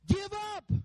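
high, strained taunting cry; devil's high-pitched jeer from ringside; shouting. Acted. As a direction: shout it at a fighter to make them quit. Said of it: "as loud as they're shouting".

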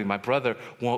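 usual speaking voice

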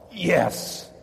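a hint of a triumphant yes; silent cheer. Preacher recalling his reaction to the reply, mild triumphant yes. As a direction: cheer it inside.

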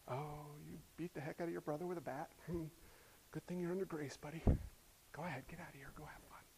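lax parent, soft and low; a soft, indulgent parent waving off a beating; soft mock-lenient parent. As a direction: shrug it off gently.

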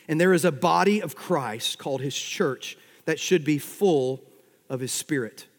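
usual speaking voice

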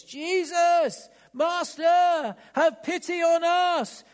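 high desperate shout from far off; loud cry. Acted. Lepers calling to Jesus from afar, high loud desperate cry. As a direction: cry out for pity across a distance. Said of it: "called out in a loud voice".

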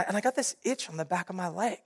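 usual speaking voice

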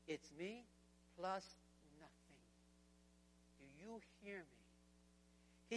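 very soft and low, pausing between words; slow, solemn, quiet command; hushed, weighty. Acted. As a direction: drop to a near-hush to drive it home. Jesus laying down agenda to Peter.

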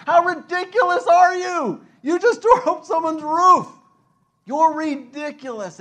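high, loud scornful jeer; mocking jeer. Onlookers jeering at friends who tore up the roof, loud and high. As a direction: scoff at them for tearing up a roof.

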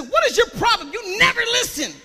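put-on scolding a belligerent child in church; reprimanding. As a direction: tell off a rude kid. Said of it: "in a very reprimanding voice".

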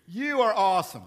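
Satan sweet-talking Jesus, giddy and loud; flattering Satan. Acted. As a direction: gush over him like a smooth-talking devil. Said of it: "Satan always says".

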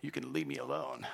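soft yawning drowsy voice; quiet, sleepy cat fresh from a nap. Acted. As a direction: mumble it half-asleep, wanting to be left alone. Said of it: "kitty cat stretch, yawn".

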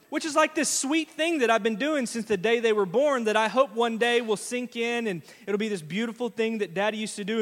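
usual speaking voice

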